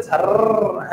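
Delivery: "सर" with a long rolled R; exaggerated wrong pronunciation. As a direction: trill the end of "सर": sarrr. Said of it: "ऐसे तो नहीं पढ़ रहे". ("सर" का र खींचकर)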